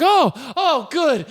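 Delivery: high, drawn-out delighted oohs; mock relief. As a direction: imitate someone suddenly thrilled and relieved. Actor's relieved reply, retold.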